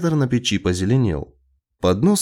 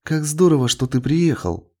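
higher, delighted welcome; joyful; 6 semitones higher than usual. A narrator's reading. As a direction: greet the child with open joy. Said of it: "радостно воскликнула Джилл".

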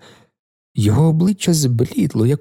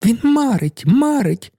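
high, gleeful teasing; merry exclamation; 9 semitones higher, slower than usual. A narrator's reading. Camilla announces that he is daydreaming, merry and high. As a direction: sing out the tease gaily, pitched high. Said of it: "вигукнула вона весело".

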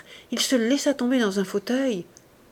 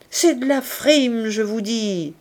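louder, higher and slower, hammering the claim; angry insistence; 3 semitones higher, 4 dB louder than usual. A narrator's reading. Brigadier insisting to the commissaire, angry and loud. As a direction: insist forcefully, losing patience. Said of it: "répéta le brigadier".

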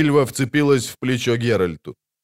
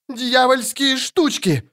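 high, slow outcry; priest cries out; 12 semitones higher, slower than usual. A narrator's reading. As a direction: cry it out as a frightened accusation. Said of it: "вскричал жрец".

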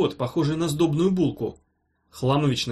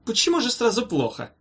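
high-pitched mock astonishment; airy, surprised professor; 8 semitones higher than usual. A narrator's reading. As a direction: act taken aback by the gloom. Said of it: "удивился Хламович".

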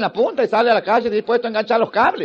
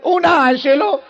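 mock Italian; 4 semitones higher, much slower than usual. Thief fakes angel Italian.

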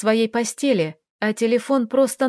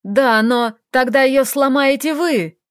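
громко и медленно, с отчаянием; выкрикнуть обвинение; крик; 4 semitones higher, 5 dB louder than usual. Acted. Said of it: "заорал Роман". (Роман орёт на похитителя, громко и отчаянно)

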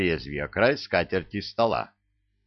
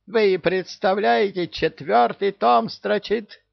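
high, loud, rising hysteria; on the edge of hysterics; near hysterics; 13 semitones higher, 4 dB louder than usual. A narrator's reading. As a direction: parrot losing it. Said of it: "начал впадать в истерику попугай".

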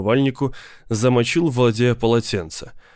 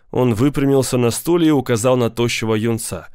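ordinary delivery

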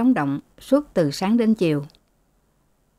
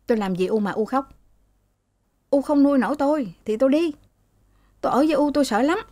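high, fast, resentful son retorting to his mother; sulky angry son; 7 semitones higher, much faster than usual. A narrator's reading. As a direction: snap back at mom, rushed and high-pitched. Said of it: "Những lúc tức". (con trai cáu kỉnh, giọng cao và nhanh)

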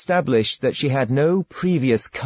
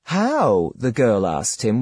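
plain reading voice

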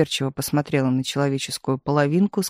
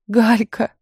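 crying; 5 semitones higher, 3 dB louder than usual. A narrator's reading. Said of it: "заплакала Таисия".

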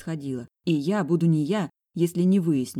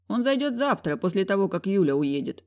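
usual speaking voice

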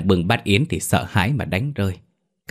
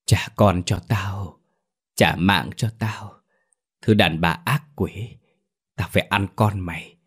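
vengeful ghost. Acted. Maid imitates the ghost demanding her child back, vengeful. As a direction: imitate a wronged ghost, drawing out the words. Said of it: "Còn ma nó nói thế đấy".

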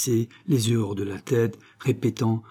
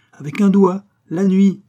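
high, loud showman's flourish; proud boasting; 10 semitones higher, 9 dB louder than usual. A narrator's reading. Old locksmith boasting to Malouin of his lock, exultant. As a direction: crow about how easy it is.

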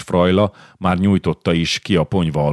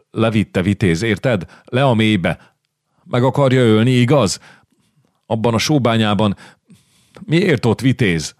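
higher-pitched, mildly agitated questioning; a touch of distress, pitched up; distressed pleading; 5 semitones higher than usual. A narrator's reading. Exhausted boy telling his rescuer of the abducted child, distressed.

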